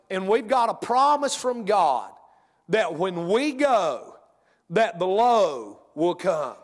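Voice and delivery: drawn-out preacher cadence on "promise"; slow preaching. Acted. Preacher tells church slow and drawn-out. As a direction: preach it slowly, every word weighed.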